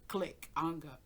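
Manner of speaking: normal talking voice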